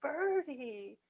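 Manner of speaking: high, slow soft bird talk; baby talk to a bird. Acted. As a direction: coo gently at a little bird. Talking to bird on finger.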